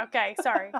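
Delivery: normal talking voice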